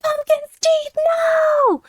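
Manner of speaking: high, slow hammed-up crying wail; theatrical sobbing no; mock wail. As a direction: wail in mock grief for the cat. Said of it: "I was like".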